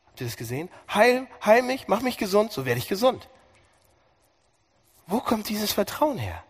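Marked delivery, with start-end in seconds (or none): 0.9-3.3 hoch und laut gerufen; inbrünstiges Gebet. Acted. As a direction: bete voller Zuversicht. Prediger spielt Jeremias Gebet. (hoch gerufenes, zuversichtliches Gebet)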